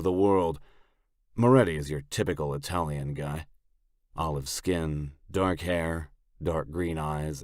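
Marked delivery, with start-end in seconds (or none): none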